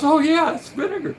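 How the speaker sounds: high, sudden realisation; doctor catching on. Retold or imitated. Filipino doctor realising pickles mean vinegar, high surprise. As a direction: pitch up as it clicks. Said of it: "He goes".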